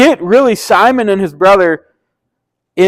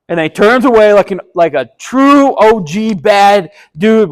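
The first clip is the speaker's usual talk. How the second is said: ordinary delivery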